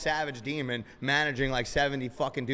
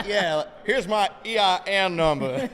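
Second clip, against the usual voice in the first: slow, higher, louder, breaking into laughter; laughing mock reply; 6 semitones higher, 8 dB louder, slower than usual. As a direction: giggle through a sweet put-on answer.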